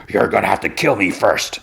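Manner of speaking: rough, monstrous distorted voice. Acted. Parker fighting the suited woman, in a distorted voice. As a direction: distort it like a creature mid-change. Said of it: "And in Distorted".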